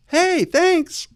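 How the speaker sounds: exaggerated bubbly thanks with an exclamation point; mock chirpy email.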